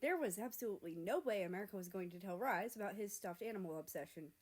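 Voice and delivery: ordinary delivery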